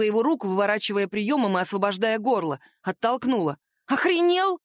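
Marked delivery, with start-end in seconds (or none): 3.9-4.6 shocked shout. A narrator's reading. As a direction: yell it in disbelief. Wife shocked by attack.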